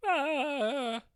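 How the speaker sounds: a high gasped "Ah" of dismay; mock shocked gasp. Contestant gasping as the song starts, high mock gasp.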